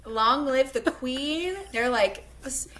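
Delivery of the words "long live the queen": slow, higher, solemn royal toast; hammed-up loyal vow, drawn out on queen; grand proclamation. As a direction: proclaim it like sworn knights hailing their queen. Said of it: "The frat pack?".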